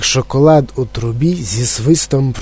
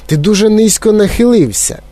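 high-pitched worried warning; mock yell; 8 semitones higher than usual. A narrator's reading. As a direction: cry a warning without full volume. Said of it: "репетував пан Глуп". (стривожений високий вигук)

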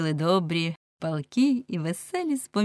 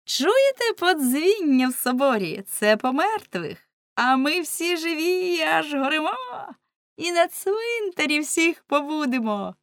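merry calling out; 10 semitones higher, 3 dB louder than usual. A narrator's reading. Young women drinking wine answer the grumblers, merry lilting call. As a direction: call out gaily over the wine. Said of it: "весело попиваючи венце, гукали".

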